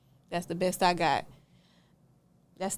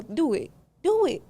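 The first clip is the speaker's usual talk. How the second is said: each "Do it" climbing higher; daring; 8 semitones higher than usual. Retold or imitated. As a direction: goad her, rising each time. Daring the renter to sue.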